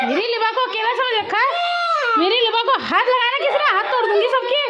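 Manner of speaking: high-pitched, hammed-up protective rant; playful bodyguard bluster; mock threat. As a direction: challenge all comers in pretend outrage. Mock bodyguard defending Liba.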